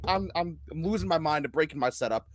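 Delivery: normal talking voice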